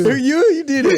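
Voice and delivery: high, loud accusing squeal; high-pitched blaming cry; mock accusation. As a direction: point the finger at a stranger. Mock accusing in fart scene.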